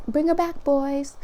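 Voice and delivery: high playful call to the guides. Pretend. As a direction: cheer them on, bring her back.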